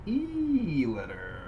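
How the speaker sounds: high, stretched segment intro; slow, playful show-segment lilt; drawn-out announcement. Pretend. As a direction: draw it out high, then drop.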